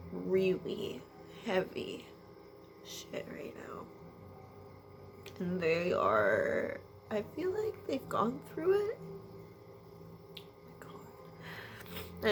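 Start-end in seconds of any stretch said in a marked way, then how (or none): 2.8-3.8 whisper. Real, not acted. Emotional reader whispering. 10.4-12.3 whisper. Real, not acted. Overwhelmed, breathy exclamation.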